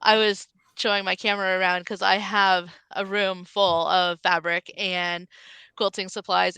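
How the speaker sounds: normal talking voice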